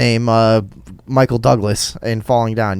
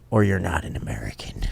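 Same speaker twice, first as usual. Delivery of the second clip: dropped to a whisper; 6 semitones lower, 9 dB quieter than usual. Acted. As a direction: whisper the punchline. Mock immigration rule.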